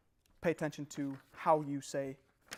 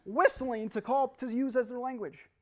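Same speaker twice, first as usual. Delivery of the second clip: loud, high, drawn-out words; emphatic insistence; 9 semitones higher, 8 dB louder, slower than usual. Real, not acted. Speaker resuming over the audience's laughter, emphatic insistence. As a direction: push it out slowly, loud and high.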